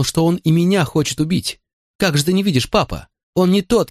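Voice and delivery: high-voiced boy pouring out his fears; frightened young son. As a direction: tell it like an upset child pleading with Dad.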